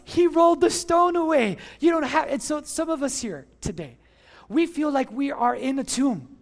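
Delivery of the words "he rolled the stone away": raised, louder preacher's answer; triumphant proclamation. Real, not acted. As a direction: declare it loudly and high. Preacher triumphantly answers his own question.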